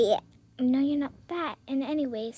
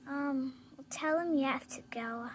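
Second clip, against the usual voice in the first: whisper; 2 semitones lower, 10 dB quieter than usual. Acted. Parent hushes child on phone.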